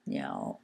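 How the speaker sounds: niao dropped very low in third tone. Shown as a demonstration. Teacher finishing the word for hummingbird, niao in third tone. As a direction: sink niao down to a low dipping tone. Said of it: "third tone".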